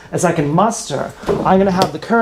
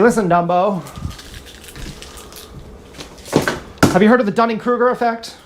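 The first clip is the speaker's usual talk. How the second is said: condescending scolding; 3 semitones higher, faster than usual. Pretend.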